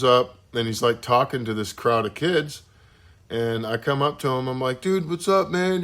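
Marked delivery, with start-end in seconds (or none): 4.8-5.8 higher-pitched, eager fan greeting; retold starstruck hello, pitched up; excited greeting. Teen fan coming up to the band's singer, excited. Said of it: "I was excited".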